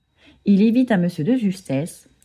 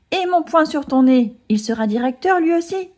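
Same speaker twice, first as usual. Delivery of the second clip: angry threat; 8 semitones higher than usual. A narrator's reading. Eudes threatening the teacher's pet, mock angry. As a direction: snap back, fist raised. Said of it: "s'énerve Eudes".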